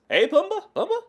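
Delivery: lightly voiced cartoon meerkat calling out; Timon voice. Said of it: "I sound more like Timon".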